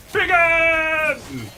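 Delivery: high, loud scream. Acted. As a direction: scream the name at full blast.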